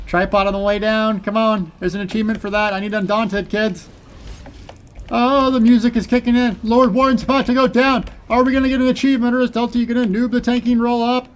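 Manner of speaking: high, loud excited play-by-play; hyped commentary. Real, not acted. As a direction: keep the hype up. Final boss phase hype.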